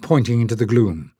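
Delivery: usual speaking voice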